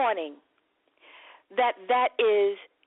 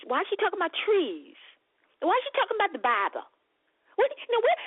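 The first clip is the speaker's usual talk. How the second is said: mock confused patient; 7 semitones higher, faster than usual.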